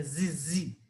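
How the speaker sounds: usual speaking voice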